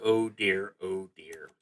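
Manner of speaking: normal talking voice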